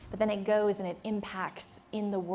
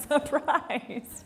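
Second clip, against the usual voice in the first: spring it on them with a laugh; laughing surprise; 7 semitones higher than usual. Real, not acted. Speaker teasing workshop room with a laughing surprise.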